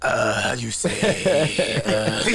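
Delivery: put-on slow, low, drawn-out Spanish-accented voice; Antonio Banderas. Guest offering Spanish accent options, slow celebrity impression. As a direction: take it down and stretch it out, hesitating. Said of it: "You want Antonio Banderas?".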